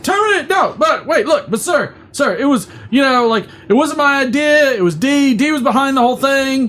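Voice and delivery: high, frantic begging; panicked pleading. Acted. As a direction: beg the boss, rushing. Panicked pleading after being fired.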